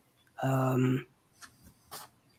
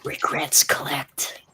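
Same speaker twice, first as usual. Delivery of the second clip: slow, voiceless whisper; low whisper; 4 dB quieter than usual. Acted. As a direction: whisper it slowly, drawing out the words. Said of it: "you hear a whisper, very low".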